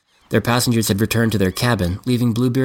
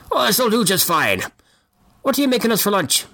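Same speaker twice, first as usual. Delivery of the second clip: put-on old man voice; 11 semitones higher than usual. Bart from the cabin.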